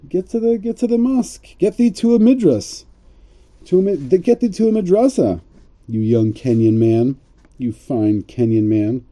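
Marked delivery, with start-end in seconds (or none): 0.1-5.4 high, hammed-up rabbi telling him off; lightly voiced rebbe sending him to study; mock rabbi scolding. Rabbi mocking Obama, lightly hammed-up high scold.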